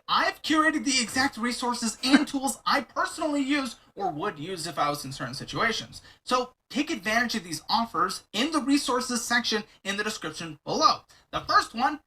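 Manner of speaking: high-pitched, energetic promotional read; sponsor ad read. Real, not acted. Host selling sponsor offers to listeners, energetic ad read. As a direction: pitch the offers with punchy, upbeat emphasis.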